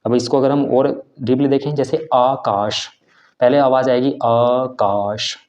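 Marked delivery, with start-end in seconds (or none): 2.1-5.4 आकाश with the आ drawn out very slowly; आकाश slow with a long open आ; long vowel. Shown as a demonstration. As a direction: stretch the आ of आकाश to two beats. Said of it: "आकाश दो मात्रा होती है".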